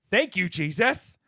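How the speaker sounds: high, loud hammed-up hallelujah; over-the-top mock gratitude; mock praise. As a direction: belt it out like a church testimony gif. Acting out gif caption.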